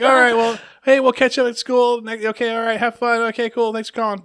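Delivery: high, hurried-off goodbye, loud at first then easing; kids' phone sign-off. Retold or imitated. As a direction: imitate kids wrapping up a phone call.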